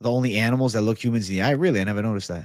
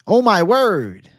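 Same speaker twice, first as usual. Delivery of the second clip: higher, louder, slow "Oh my word"; mock pious exclamation; 9 semitones higher, 7 dB louder, slower than usual. Shown as a demonstration. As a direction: stretch out "Oh my word" like a shocked churchgoer. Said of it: "I'm gonna say it like this".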